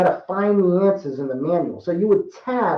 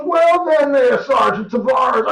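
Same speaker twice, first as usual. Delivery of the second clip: a much higher, louder major's voice, the rank drawn out slowly; imitating the major; 8 semitones higher, 5 dB louder, slower than usual. Major stops at the table and greets the sergeant, in a high, loud voice. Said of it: "that's just how he talked".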